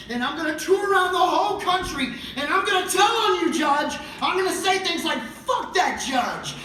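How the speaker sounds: loud, high furious tirade; angry rant. Acted. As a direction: shout it at the judge.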